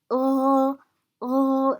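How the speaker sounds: long, level "uh", not "eh"; teacher modelling a sustained "uh" vowel; vowel demo. Shown as a demonstration. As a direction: hold "uh" loud and steady. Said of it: "This is uh, not eh".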